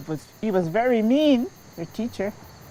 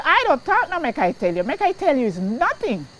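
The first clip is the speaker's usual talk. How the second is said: indignant; 7 semitones higher, 5 dB louder than usual. Real, not acted. Indignant rebuttal, pitch up.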